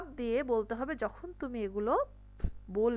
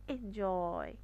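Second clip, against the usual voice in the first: Enjoy with a soft EN and long JOY; stress on second syllable; 5 semitones lower, 4 dB quieter, much slower than usual. Shown as a demonstration. Teacher emphasising the second syllable, drawn out. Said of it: "জয়টাকে খুব চাপ দিয়ে".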